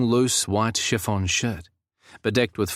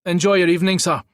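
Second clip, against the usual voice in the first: high, loud Scottish send-off; Scottish man; 9 semitones higher, 6 dB louder, faster than usual. A narrator's reading. Guard waves guest through, Scottish accent. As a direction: send them off in broad Scots. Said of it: "the Scottish man returned".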